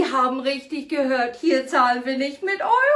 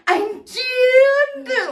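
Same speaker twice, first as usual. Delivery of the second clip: excited; 8 semitones higher, 5 dB louder than usual. Acted. Shopping host presents item.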